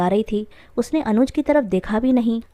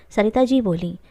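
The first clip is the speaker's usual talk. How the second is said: ordinary delivery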